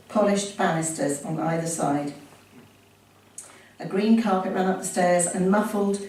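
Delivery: ordinary talk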